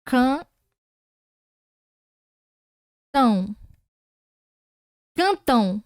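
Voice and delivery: teacher sounding out Cantão; demo pronunciation. Shown as a demonstration. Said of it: "Ó".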